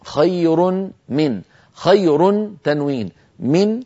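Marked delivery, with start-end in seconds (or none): 0.1-1.4 خير من ببطء بتنوين مدغم في الميم; أدغم التنوين في الميم; إدغام التنوين بغنة. Shown as a demonstration. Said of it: "مثال التنوين". (إدغام التنوين مع غنة)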